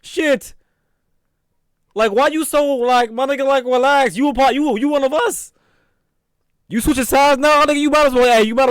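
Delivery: high-pitched exasperated complaint; fed-up and incredulous, pitched high; indignant. Real, not acted. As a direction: scold in a high, worked-up voice. Indignant back-talk at the police officer.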